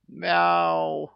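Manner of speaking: high, drawn-out cat wail; hoarse, strained meow. A narrator's reading. Cursed cat wailing over a forest glade, strained meow. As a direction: yowl with a straining throat. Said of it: "чей-то хриплый надсадный голос".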